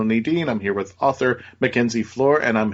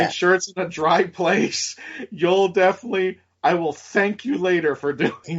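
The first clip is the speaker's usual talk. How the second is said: advising younger self; 6 semitones higher than usual. Acted. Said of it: "I would have said".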